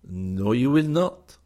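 very slow, firm no; firm refusal. A narrator's reading. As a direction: lay it down with total certainty. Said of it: "with equal positiveness".

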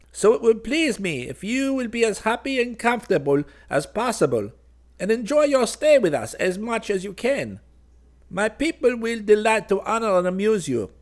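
high-pitched royal welcome; gracious bird king. Acted. Bird king welcoming the child larks as guests, high-pitched and grand. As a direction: play a piping monarch bestowing hospitality.

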